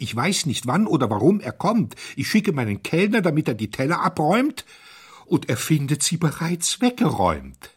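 high, loud, flustered complaint; agitated protest. A narrator's reading. Hotelier explaining the vanished plates, agitated. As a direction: build into a helpless, upset protest.

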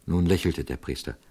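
ordinary delivery, nothing marked

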